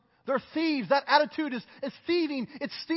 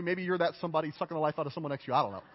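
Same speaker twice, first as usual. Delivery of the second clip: ordinary talk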